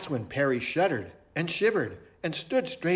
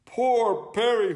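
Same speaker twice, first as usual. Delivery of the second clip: concerned owl detective; 9 semitones higher, 4 dB louder than usual. Acted. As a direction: pity the victim in a high owl voice.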